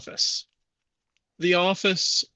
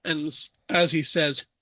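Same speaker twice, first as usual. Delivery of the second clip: ordinary delivery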